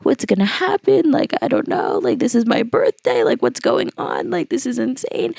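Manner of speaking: high, breaking voice of someone near tears; mock sobbing lines, high and wavering; retold crying. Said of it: "I just started to cry".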